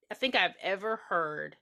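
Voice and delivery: usual speaking voice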